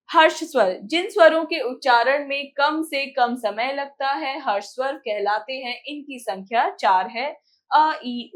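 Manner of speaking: usual speaking voice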